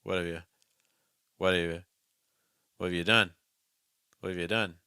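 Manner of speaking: "what have you" reduced to whaddav-ya; further reduction. Shown as a demonstration. Teacher offers reduced form, "you" weakened to ya. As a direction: weaken "you" to ya, stress "done". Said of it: "or you could reduce to".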